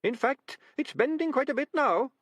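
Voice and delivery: very high, muffled tiny rat; a small rat speaking from behind furniture; muffled little voice. A narrator's reading. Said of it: "which was slightly muffled".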